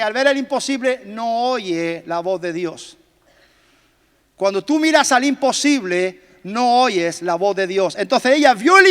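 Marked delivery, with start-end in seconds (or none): 1.1-3.0 slowed emphasis. Acted. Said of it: "slow emphasis". 6.4-8.0 slowed emphasis. Acted. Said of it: "slow emphasis".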